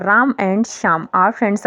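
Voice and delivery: each word set apart, very slowly; very slow dictation. Shown as a demonstration. Teacher dictates the sentence to students, very slowly. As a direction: read it out word by word for beginners. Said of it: "repeats example slowly".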